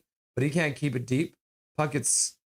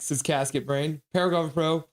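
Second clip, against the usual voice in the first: ordinary talk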